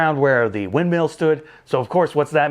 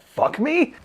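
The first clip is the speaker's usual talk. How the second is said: cheeky mock proposition; 11 semitones higher than usual. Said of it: "he goes like".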